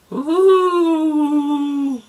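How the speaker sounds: high wailing ghost woo, loud; spooky ghostly woo, rising; ghost impression. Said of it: "it's my impression of a ghost".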